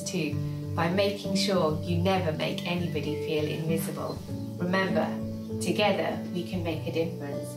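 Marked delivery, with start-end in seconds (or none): none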